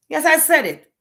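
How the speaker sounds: defiant yes I said it. Real, not acted. Defiant rant to viewers.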